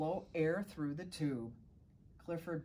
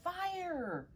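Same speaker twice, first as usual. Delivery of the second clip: shouting for help; 12 semitones higher than usual. Acted. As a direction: cry out high in alarm. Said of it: "we heard someone shouting".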